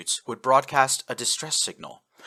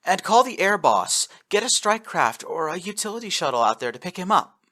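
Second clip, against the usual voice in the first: raised, hurried command; urgent orders; 9 semitones higher, 5 dB louder than usual. A narrator's reading. Captain to her crew, urgent raised orders. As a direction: push the crew to move now.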